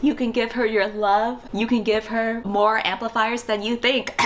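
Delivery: high, slow dramatic plea; mock pleading. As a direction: beg him like a lovesick fan. Pleading with a character.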